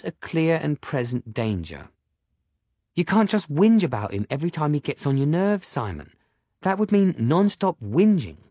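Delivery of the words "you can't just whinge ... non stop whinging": put-on scolding girl voice.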